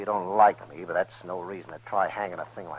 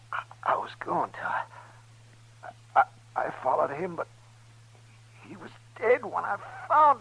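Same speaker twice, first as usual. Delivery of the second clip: dying plea; 8 semitones higher than usual. Acted. Dying man pleading innocence.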